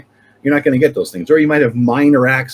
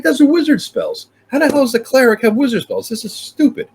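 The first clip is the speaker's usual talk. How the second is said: high-pitched mock outrage over stolen spells; indignant disbelief; 12 semitones higher than usual. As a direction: squeal it out, indignant. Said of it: "I'm like".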